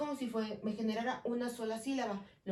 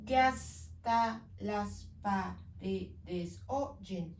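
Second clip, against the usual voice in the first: very slow syllable count; much slower than usual. Shown as a demonstration. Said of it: "me salen ocho sílabas".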